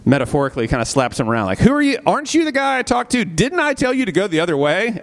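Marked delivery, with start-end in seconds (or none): 1.6-5.0 scolding evangelist. Retold or imitated. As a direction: snap at him like a preacher catching a runaway.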